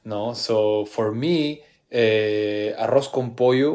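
ordinary talk, nothing marked

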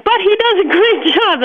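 a laugh breaking into the line. Real, not acted. Farmer telling the host about the barn painters, laughing.

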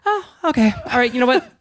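high sing-song calm; forced calm. Retold or imitated. Imitating Zara forcing calm.

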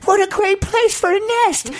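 very high squeaky bird voice; bird's voice. Retold or imitated. Host voicing a bird thrilled with the golf course. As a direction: squeak it out like a happy little bird.

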